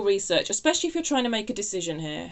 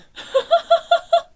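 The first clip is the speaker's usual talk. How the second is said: high burst of laughter; 11 dB louder than usual. Real, not acted. Surprised at own channelled message.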